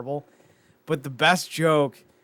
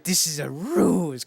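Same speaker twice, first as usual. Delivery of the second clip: slow, badly faked German accent; 3 semitones higher, slower than usual. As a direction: attempt a German accent, stretching "ruse". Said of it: "I can't do a German accent".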